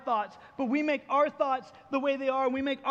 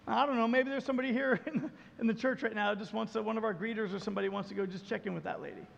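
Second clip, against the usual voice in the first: ordinary delivery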